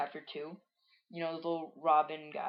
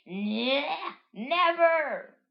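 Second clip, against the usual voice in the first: high, loud cartoon shout; hammed-up defiant cry; acted defiant yell; 9 semitones higher, 6 dB louder, much slower than usual. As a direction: strain up into a yelled refusal. Characters argue in comic.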